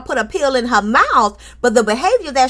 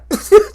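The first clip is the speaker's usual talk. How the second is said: high squealing laugh; 10 semitones higher, 7 dB louder than usual. Real, not acted. Host cracking up.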